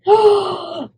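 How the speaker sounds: high, drawn-out excited gasp; big excited intake of breath; delighted gasp. Real, not acted. Delighted at finding photos in box.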